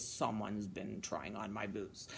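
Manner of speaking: very low, soft bear demo; big bear voice. Shown as a demonstration. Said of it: "Can you make a big bear voice?".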